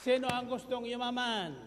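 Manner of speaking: full-force preaching. Acted. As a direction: drive the point home to the congregation.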